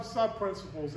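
ordinary delivery

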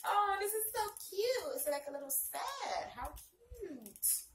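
high-pitched gushing squeal; real squeal over something adorable; delighted cooing. Real, not acted. Delighted by cute baby set.

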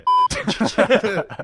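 laugh. Real, not acted. Friends laugh at the impression.

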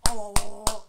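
loud mock wail of oh. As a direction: wail the ohs loudly like an upset fan. Fan toy wailing upset.

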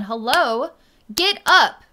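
hammed-up shout at a paralyzed person; mocking the demanding energy, loud yell. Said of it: "demanding figure".

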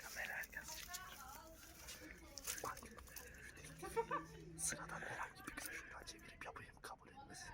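hushed secret whisper; whispering while hiding. Real, not acted. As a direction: whisper it behind the camera. Secret talk behind the camera. (gizlice fısıldama)

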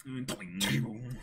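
usual speaking voice

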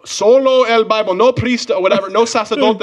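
high, loud preaching rant; ranting preacher man. Retold or imitated. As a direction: lecture him like a zealot. Imitating ranting evangelical man.

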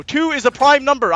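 usual speaking voice

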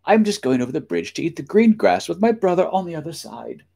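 high-pitched goat explaining; high, innocent goat voice; middle billy goat. A narrator's reading. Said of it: "said the goat".

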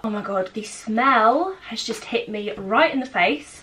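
ordinary talk, nothing marked

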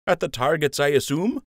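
lightly voiced teasing buddy; teasing friend. A narrator's reading. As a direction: poke fun gently. Lund voiced by narrator.

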